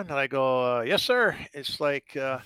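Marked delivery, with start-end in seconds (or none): 0.8-1.4 timid yes sir. Retold or imitated. Young player answering scouts, retold.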